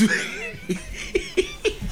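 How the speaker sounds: shrieking high laugh; high laughter. Real, not acted. Crowd erupts in laughter.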